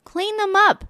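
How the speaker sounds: mother's order. Acted. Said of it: "said the mother".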